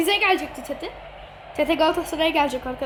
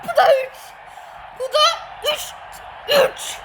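taunting; 9 semitones higher than usual. Real, not acted. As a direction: rub the goal in his face. Taunting friend after scoring. (arkadaşına yüksek sesle zafer naraları)

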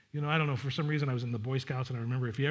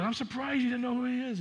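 son, surprised; 10 semitones higher than usual. Retold or imitated. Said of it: "He goes".

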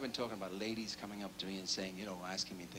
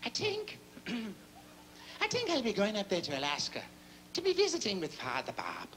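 much higher Irish brogue, slowish; Irish bishop; 10 semitones higher than usual. Acted. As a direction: play the bishop in a high Irish lilt. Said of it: "So he says".

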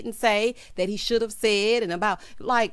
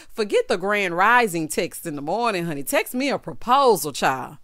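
ordinary talk, nothing marked

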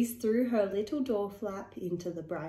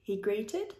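ordinary delivery, nothing marked

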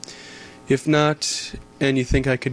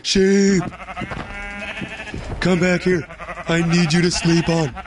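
excited shouting; 6 semitones higher than usual. Real, not acted. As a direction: yell after the runaway sheep. Chasing sheep, calling out.

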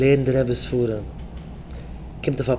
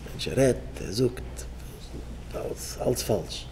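whisper; 3 semitones lower, 13 dB quieter than usual. Acted. Whispered aside.

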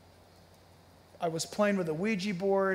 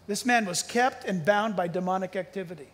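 usual speaking voice

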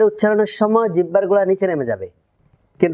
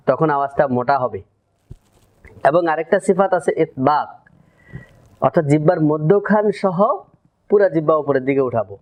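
ordinary delivery

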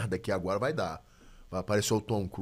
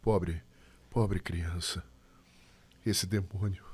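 lower, softer, gentle and mournful; a hint of a calm giant grieving; soft-spoken warrior monk; 5 semitones lower, much slower than usual. Shown as a demonstration. Voice actor shows his anime character's line, low and soft. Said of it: "Ele fala meio suave, assim".

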